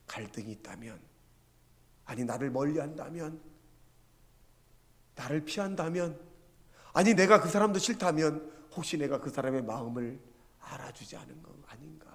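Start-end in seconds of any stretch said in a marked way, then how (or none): none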